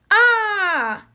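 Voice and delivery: high, loud scream of fright; startled stepmother's shriek. A narrator's reading. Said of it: "screamed his stepmother".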